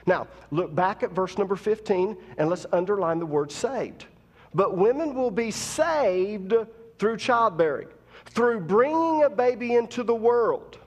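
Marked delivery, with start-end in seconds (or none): 4.5-10.8 building preacher cadence, rising; preaching. Acted. Preacher to women in congregation, building preaching cadence. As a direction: lift the verse like a preacher.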